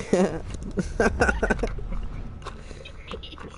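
breathless wheezy laugh; silent wheezing laugh. Real, not acted. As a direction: laugh almost without voice. Laughing at own joke.

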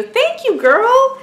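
high squealed thank you; gushing high-pitched thanks; excited thanks. Real, not acted. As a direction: squeal your thanks to her. Thanking the friend who sent gifts.

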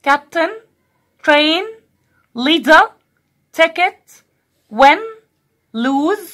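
rising list reading, word by word; teacher reading the vocab list aloud; vocab drill. Shown as a demonstration. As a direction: read the vocab list for the class to repeat. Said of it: "معايا تاني كده في الفوكاب".

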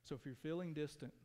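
soft, hushed sermon voice; gentle reflective preaching; quiet intimate preaching. Acted. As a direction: draw them in with a low, soft voice.

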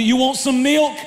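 ordinary talk

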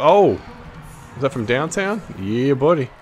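ordinary talk, nothing marked

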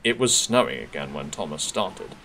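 ordinary delivery

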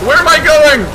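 loud, high panicked yell; real panic; terrified. Real, not acted. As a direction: shout it, lost and frightened. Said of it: "I'm terrified!".

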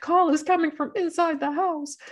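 high-pitched horror-movie warning; hammed-up scary-film line, pitched high; mock horror caller. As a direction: raise the voice into a spooky phone warning.

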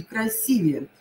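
Красивее with stressed И; Красивее, second syllable stressed. Shown as a demonstration. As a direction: hit «си» in Красивее. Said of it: "ударение на И".

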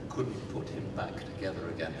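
hushed, fast whisper of a grim ending. Acted. Comedian telling the audience how Humpty ended, in a quick whisper. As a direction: breathe the last line like a dark secret.

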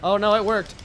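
ordinary talk

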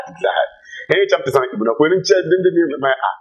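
usual speaking voice

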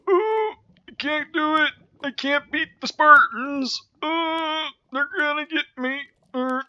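high, hammed-up cry of a doomed hero; mock Achilles. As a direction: whine like a cornered Greek warrior. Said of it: "I'm kinda like Achilles right now".

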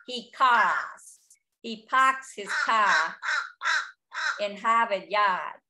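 broad Boston vowels with the R's dropped; New England accent. Retold or imitated. Birder explaining how the fish crow sounds, Boston accent. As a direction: imitate a Boston accent, stretching the open A sounds. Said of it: "comes from New England".

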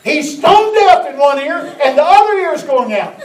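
loud mock daughter explaining; voicing the daughter. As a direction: belt it out like the daughter. Daughter answering for her father.

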